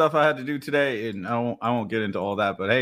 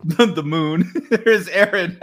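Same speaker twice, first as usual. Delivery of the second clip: laughing through the intro; 4 semitones higher, 4 dB louder, slower than usual. Real, not acted. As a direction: giggle out the name slowly.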